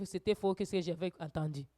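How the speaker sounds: normal talking voice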